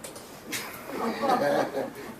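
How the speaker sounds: real high-pitched chuckle; amused laugh. Real, not acted. The poet jokes about being conceived in Puerto Rico, amused laugh. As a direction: laugh at your own joke.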